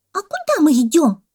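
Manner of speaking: high, loud monkey cry; shouting monkey. A narrator's reading. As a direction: demand it in a squeaky yell. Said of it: "закричала мартышка". (крик мартышки)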